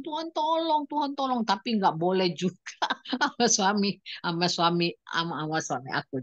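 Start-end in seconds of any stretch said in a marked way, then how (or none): 0.0-1.4 high, tearful cry for help; crying plea. Retold or imitated. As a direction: call to God through tears. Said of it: "Nangis aku". (memohon tolong sambil menangis)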